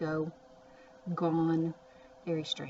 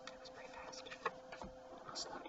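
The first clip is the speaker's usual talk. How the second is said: whispered aside; 18 dB quieter, much faster than usual. Real, not acted. Quiet aside to self.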